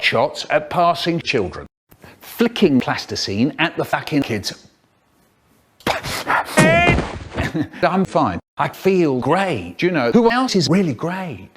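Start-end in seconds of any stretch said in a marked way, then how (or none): 5.8-7.8 high breathy laugh. Real, not acted. Laugh alone on a line.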